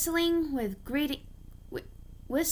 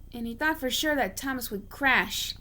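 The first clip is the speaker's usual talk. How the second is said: ordinary delivery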